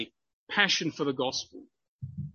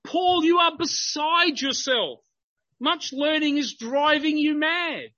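raised, higher-pitched quoted accusation; loud voice; 7 semitones higher than usual. Acted. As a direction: read it out as a loud public rebuke. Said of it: "Festus said with a loud voice".

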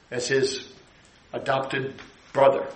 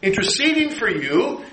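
ordinary talk

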